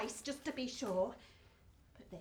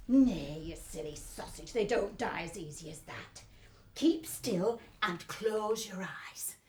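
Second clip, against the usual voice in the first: father's voice; 7 semitones lower, 3 dB louder than usual. Acted.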